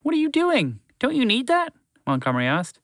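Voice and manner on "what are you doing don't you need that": high, timid little mouse; small mouse voice. A narrator's reading. Mouse to horse, unsure suggestion in a high mouse voice. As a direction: squeak it out gently like a tiny mouse.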